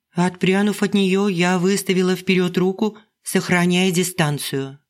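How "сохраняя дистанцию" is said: ordinary delivery, nothing marked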